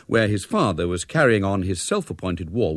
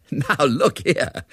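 slow, high, amused protest; laughing; 6 semitones higher, much slower than usual. A narrator's reading. Major answers Ethel's absurd question, laughing. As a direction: protest through a laugh, drawing it out. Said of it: "said the major with another laugh".

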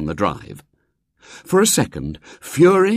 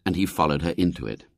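ordinary delivery

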